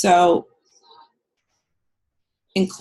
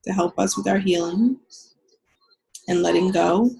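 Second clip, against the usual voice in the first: calm guided healing meditation. Acted. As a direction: ease them into the visualization. Leads a guided visualization.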